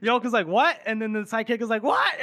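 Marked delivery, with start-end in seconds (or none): 0.5-0.8 loud, high acted question; mock shock through laughter; startled disbelief. Recruit Yolk hears the order to shoot, startled. 1.8-2.1 loud, very high acted question; hammed-up alarm, pitch leaping; alarmed disbelief. Sidekick learns he may be shot, alarmed. Sidekick hears the shooting order.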